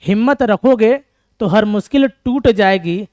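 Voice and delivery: loud, slow declaiming to spur students on; motivational recital. Shown as a demonstration. Teacher urges students not to quit, declaiming loud and slow. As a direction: proclaim each line slowly and loudly, like a rallying poem. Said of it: "ध्यान सुनिएगा".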